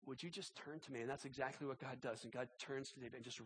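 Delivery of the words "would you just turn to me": soft, halting plea; simple prayer. Retold or imitated. Preacher showing how simply one can pray, hushed plea. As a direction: ask it gently, much quieter, almost to yourself. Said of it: "It's just a simple prayer".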